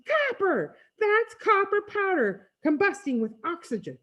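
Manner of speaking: high-pitched, thrilled cry; high, breathless-with-delight exclamation; excited scream. A narrator's reading. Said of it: "Freak tugs my hair and screams".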